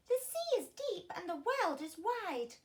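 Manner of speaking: high, longing snail voice; wistful little snail. A narrator's reading. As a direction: dream aloud of the wide sea. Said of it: "said the tiny snail".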